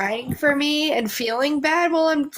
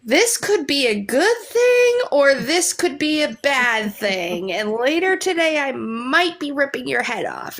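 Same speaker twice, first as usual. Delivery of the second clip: mock warning; 4 semitones higher, slower than usual. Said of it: "I'll look at him and go".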